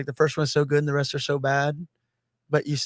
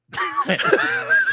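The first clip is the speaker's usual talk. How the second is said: squealing laugh way up high; high disbelieving laugh. Real, not acted. Laughing at a fifteenth Air Bud movie, high squeal.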